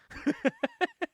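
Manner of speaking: a short, very high burst of laughter. Real, not acted. Amused by the villain taking the girl, high laugh. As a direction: squeal with laughter.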